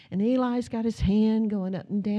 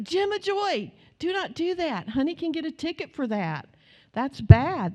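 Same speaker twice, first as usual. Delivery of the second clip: scolding; 7 semitones higher, 4 dB louder than usual. Retold or imitated. Grandma scolding granddaughter in car.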